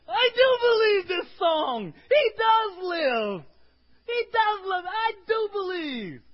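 a father's desperate cry of faith; desperate shout. Acted. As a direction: scream it like a broken father. Preacher cries out the declaration.

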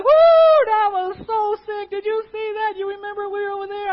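high, loud, whooping joy; hammed-up giddy cheering; excited disciples. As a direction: cheer like you just won big. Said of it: "they're just like".

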